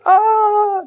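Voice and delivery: sudden high yelp of pain; pained cry. Retold or imitated. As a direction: yelp as hot coal hits the lips. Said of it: "and Isaiah goes".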